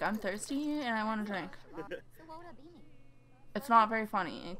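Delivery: ordinary delivery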